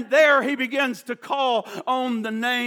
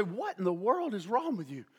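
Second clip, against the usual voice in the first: baffled spouse; 5 semitones lower, 10 dB quieter than usual. Acted. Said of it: "your husband or wife say".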